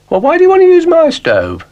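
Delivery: high, loud indignant protest; outraged little dog. Acted. Dougal indignant at Florence.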